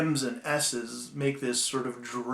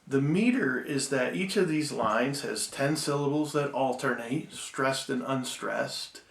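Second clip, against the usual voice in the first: ordinary delivery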